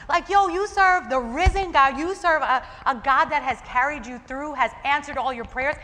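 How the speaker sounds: loud, high, incredulous scolding; indignant rebuke. Acted. Preacher scolds the Israelites for leaving God, indignant rebuke. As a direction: call them out with mounting exasperation.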